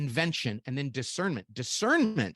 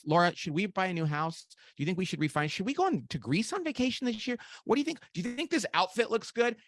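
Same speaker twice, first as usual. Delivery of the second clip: asking wife questions; 6 semitones higher than usual. Retold or imitated. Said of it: "I'll say to my wife".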